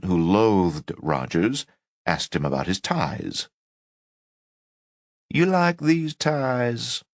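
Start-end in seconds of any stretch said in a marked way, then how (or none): 5.3-7.0 put-on slow, high, gentle Southern accent; soft Southern drawl, lifted and unhurried. A narrator's reading. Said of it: "Rogers asked in a soft Southern accent".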